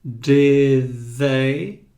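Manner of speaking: careful th in "Did they?"; tongue between teeth. Shown as a demonstration. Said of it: "e já coloca a língua pra fora".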